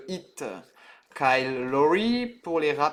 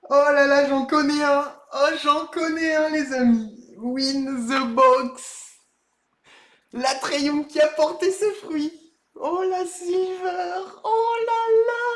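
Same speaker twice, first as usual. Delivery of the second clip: higher and louder, still buzzing with joy; excited shouting; 10 semitones higher, 3 dB louder, slower than usual. Real, not acted. Still celebrating the rare card with viewers, excited shouting. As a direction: cry out in disbelief at the pull. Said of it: "Désolé pour vos oreilles".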